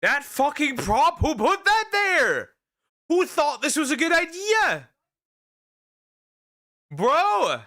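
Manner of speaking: loud, high startled yelling; startled shout. Real, not acted. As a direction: yell in real shock at the screen. Startled by prop in clip.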